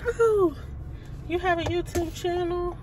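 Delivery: imitating the nurse. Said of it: "The lady was like".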